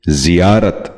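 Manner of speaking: زیارت starting with zi, "zi-yaarat"; correct زیارت. Shown as a demonstration. Teacher modelling for viewers, زیارت said as "ziyaarat". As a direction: put an i in the first syllable of زیارت. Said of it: "دوبارہ سنیے".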